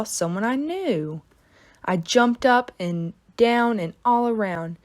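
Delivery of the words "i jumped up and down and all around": ordinary delivery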